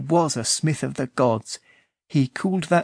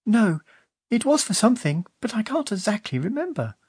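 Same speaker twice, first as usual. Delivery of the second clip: put-on girl Una, high; 8 semitones higher than usual. A narrator's reading. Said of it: "said Una".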